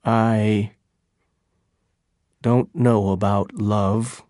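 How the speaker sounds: low, halting admission with a long pause; slow and unsure; slow, hesitant. A narrator's reading. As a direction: hesitate after the first word, then go on low. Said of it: "he said slowly".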